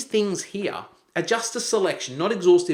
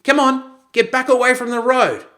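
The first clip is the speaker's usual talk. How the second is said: put-on higher, louder yell at the child; yelling dad; 8 semitones higher, 6 dB louder than usual. Dad yells at Johnny playing near the road, yelling. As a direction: yell him back from danger. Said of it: "now Dad's yelling".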